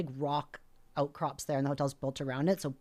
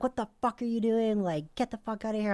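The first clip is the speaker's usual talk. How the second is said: high-pitched mock scream through a door; heated yell, only partly full force; retold angry screaming; 8 semitones higher than usual. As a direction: act out ordering an intruder away. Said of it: "I'm, like, screaming through the door".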